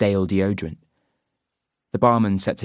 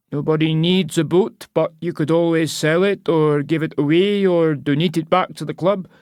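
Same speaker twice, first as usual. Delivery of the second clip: put-on high, persuasive old local; old Scottish man; 7 semitones higher, 3 dB louder than usual. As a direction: coax her like a cheeky old seller. Old man argues for raffle.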